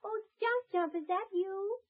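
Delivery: a hint of a gentle young woman calling through a door; lightly voiced young lady. Young lady calling to the elf from behind the door, gentle and lightly voiced.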